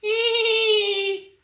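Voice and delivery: high, drawn-out mock whinny; whinny like a horse; imitated horse neigh. Shown as a demonstration. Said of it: "kuda meringkik". (menirukan kuda meringkik melengking)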